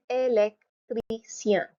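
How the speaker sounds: "électricien" slowly as ay-lek - tree - syan; four syllables. Shown as a demonstration. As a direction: break "électricien" up for a learner. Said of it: "Four syllables".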